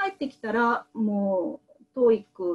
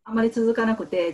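normal talking voice

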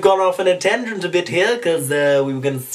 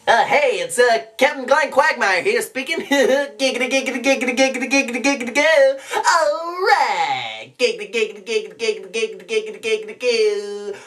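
high, rapid-fire cartoon lech; lecherous catchphrase patter, high-pitched; Glenn Quagmire; 6 semitones higher than usual. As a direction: rattle it off as a sleazy cartoon womanizer. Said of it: "that was Quagmire".

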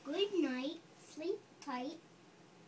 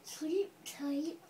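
ordinary delivery

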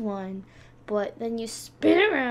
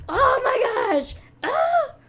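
scared scream; 12 semitones higher, 9 dB louder than usual. Real, not acted. Screams fleeing in game.

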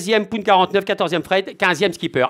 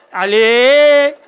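loud, higher cheering Allez!; excited shout; 4 semitones higher, 6 dB louder than usual. Acted. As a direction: shout Allez! to rally everyone. Cheering the team, louder take.

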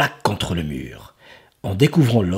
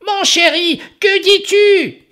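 doting ogress; 6 dB louder than usual. Acted. Ogress asking Horace, very high and doting. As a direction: lean in sweetly.

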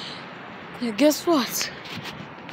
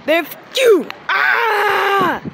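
angry yell; 6 semitones higher, 3 dB louder, slower than usual. Acted.

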